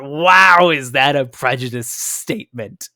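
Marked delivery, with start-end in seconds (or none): 0.0-0.7 loud, stretched wow of disbelief; appalled wow. Real, not acted. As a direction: burst out on WOW, loud and long. Exclaims at a prejudiced statement.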